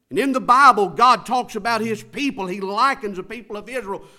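loud, high-pitched pulpit cry; full-force preaching. Real, not acted. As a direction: drive it at the congregation, stretching the big words.

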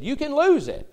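high, loud mocking quote; sneering voice of a doubter; mock objector. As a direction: toss off a jeering objection. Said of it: "a saved man that said".

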